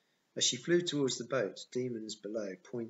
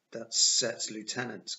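urgent, slower and louder warning; 7 dB louder, slower than usual. A narrator's reading. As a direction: warn them of a dreaded enemy. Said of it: "Carter warned".

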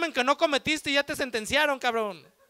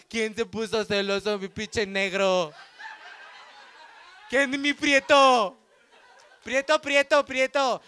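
cooing, laughing; slower than usual. Acted. Girlfriend sweet-talking her man.

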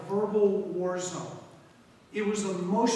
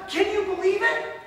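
much higher, loud incredulous cry; amazed; 12 semitones higher, 6 dB louder than usual. Real, not acted. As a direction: sound truly astonished. Preacher's amazed aside.

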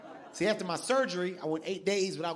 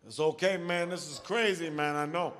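lower, gentle consoling voice; comforting nurse; 4 semitones lower than usual. Retold or imitated. Nurse comforts crying patient.

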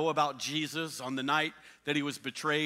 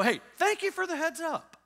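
high, light thankful tone; grateful thanks; 11 semitones higher than usual. Retold or imitated. Preacher voicing a grateful listener, high and light. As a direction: thank them cheerfully. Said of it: "No, you go".

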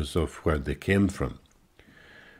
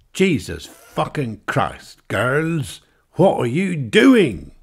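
slow, high, loud disbelief; outraged Englishman; 8 semitones higher, 6 dB louder, slower than usual. Acted. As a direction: drag it out in mock horror at the girls.

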